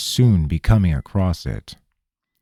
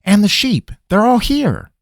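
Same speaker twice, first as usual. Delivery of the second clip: high-pitched, louder exclamation of discovery; surprised discovery; 13 semitones higher, 6 dB louder than usual. A narrator's reading. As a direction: exclaim it high, they are found. Surprised find of the lost animals.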